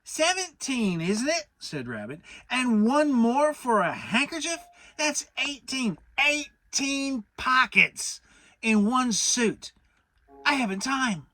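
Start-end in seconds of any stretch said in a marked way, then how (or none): 0.0-1.5 higher, louder, fussy Rabbit; a self-important rabbit holding forth; Rabbit, pitched up and loud. A narrator's reading. Rabbit voiced. 2.5-11.3 higher, louder, flustered Rabbit; a busy rabbit who can't spare the time; Rabbit, slowing over eighteen pockets; fussy Rabbit voice. A narrator's reading.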